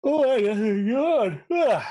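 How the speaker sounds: high, slow yawn through the words. Real, not acted. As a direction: yawn while talking. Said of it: "I got the yawns tonight".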